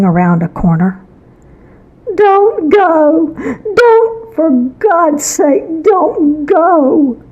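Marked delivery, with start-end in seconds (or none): 2.1-7.2 high frantic scream, near crying; a mother's terrified, much higher wail. Acted. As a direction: shriek a desperate plea to stop her child. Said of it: "I could hear my mother scream".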